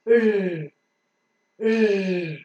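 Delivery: a long continuous R, tongue near the teeth ridge; held R sound. Shown as a demonstration. Teacher demonstrating the letter sound to learners, held R. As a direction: draw out a voiced phonic R. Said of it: "And then the air passes".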